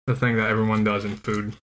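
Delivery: muffled through covering hand; chewing while speaking; talking with mouth full behind hand. Real, not acted. Seen 1: Man on right chews, hand cupped over mouth most of the time.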